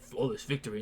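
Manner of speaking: low, quiet victory declaration; Liu Kang. Retold or imitated. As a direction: drop deep and soft for the catchphrase. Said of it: "He's like".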